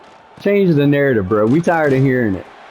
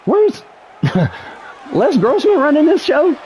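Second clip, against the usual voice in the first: exasperated yelling; 10 semitones higher than usual. Real, not acted. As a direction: yell in disbelief at the screen. Outraged at the game.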